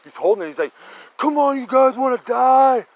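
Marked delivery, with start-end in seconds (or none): 1.2-2.9 higher, louder, slow yell to hurry them; urgent high call to come on now; urgent shout. Retold or imitated. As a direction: shout at the drunk kids to run for it. Urging friends away from Bigfoot.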